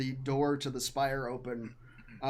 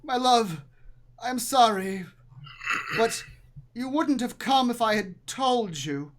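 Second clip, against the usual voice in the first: raised, loud theatrical apology; projected husband's voice, higher than usual; apologetic husband; 8 semitones higher, 7 dB louder than usual. Acted. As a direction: plead with your love in a heightened character voice. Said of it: "this time the male voice is saying".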